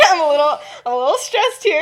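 high and loud, laughing exasperation; loud stressed outburst. Retold or imitated. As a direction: blurt out the stress. Lily snaps about being stressed.

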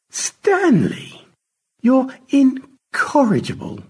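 very high, slow flirtatious scolding; playful, drawn-out flirty protest; coquettish. A narrator's reading. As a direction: coo it high, batting your lashes. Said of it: "fluttering her eyelashes".

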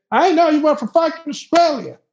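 high-pitched triumphant imitation; high, strained mock outburst; worked-up local. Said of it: "he becomes to be upset".

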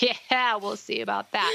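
a skeptical, laughing brush-off; sarcastic doubt. Retold or imitated. Telling God she doubts him, sarcastic and laughing. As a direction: scoff lightly at the idea. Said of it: "And I'm like".

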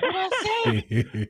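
a high, slow, laughing mock confrontation; mock street tough. Guy on the street confronting the host, mock menacing through laughter.

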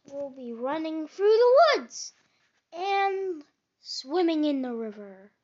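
big eager storybook Frog; excited. A narrator's reading. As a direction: paint the summer plans with rising excitement. Frog excitedly describes summer plans.

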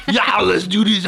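loud, hammed-up action-star impression; Arnold Schwarzenegger. Host mocking a film-star governor taking orders, hammed-up impression. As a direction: yell it like a caricatured muscleman. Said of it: "Arnold, you wanna".

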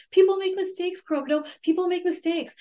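high-pitched imitated pleading; retold crying, no real sobs; mock tearful plea. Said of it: "cries and says".

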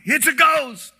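high, loud frightened shriek; terrified cry. A narrator's reading. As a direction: cry it out in sudden terror. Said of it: "and cried out in fear".

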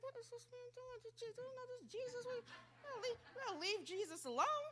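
high, very soft mocking little voice; hushed, high-pitched busybody voice; disapproving bystanders. Onlookers questioning the man calling Jesus, high hushed voice.

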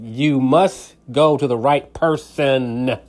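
"You must go to the right person" very slow, punching "must"; insistent slow stress on "must"; slow emphatic stress. Shown as a demonstration. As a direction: spell it out word by word for the listener. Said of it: "I need you to hear what I'm saying".

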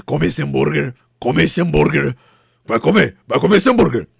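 loud, pushy threat; mock forceful command. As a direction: bark it like a gunman. Mock gunman forcing burger.

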